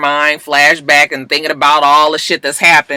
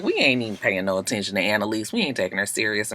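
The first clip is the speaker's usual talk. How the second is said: dismissive; 8 semitones lower, 12 dB quieter than usual. Retold or imitated. DA dismissing Annalise.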